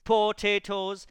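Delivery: loud, slow hobbit answer; emphatic. A narrator's reading. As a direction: drive it home.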